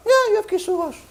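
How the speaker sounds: mock her claim in a high put-on voice; sarcastic mock agreement. Heavenly court sarcastically echoing the woman, mocking.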